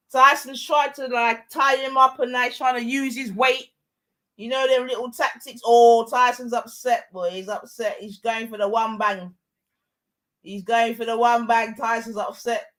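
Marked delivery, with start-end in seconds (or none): none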